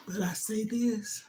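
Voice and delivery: soft whispered aside. Real, not acted. Worried mother confides to viewers, hushed whisper. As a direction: breathe it out as a private confession. Confiding after sad pause.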